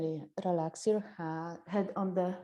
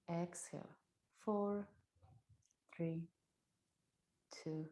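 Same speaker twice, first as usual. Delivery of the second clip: breath counting guide; 2 semitones lower, 5 dB quieter than usual. Acted.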